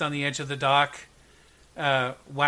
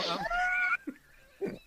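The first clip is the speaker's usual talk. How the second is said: normal talking voice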